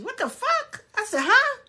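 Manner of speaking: squeaky, very high mock bewilderment; comic falsetto astonishment; baffled disbelief. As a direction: let the voice leap up in confusion. Retold bafflement at claim.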